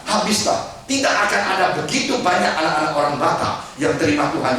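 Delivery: rising, emphatic sermon voice; preaching. Acted. Preacher presses the congregation on the Batak story, emphatic preaching. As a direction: drive the point home to the congregation.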